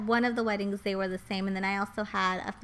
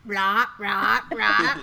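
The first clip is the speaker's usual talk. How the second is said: croaky frog sounds, louder; 6 dB louder, much slower than usual. Shown as a demonstration. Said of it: "Do it again".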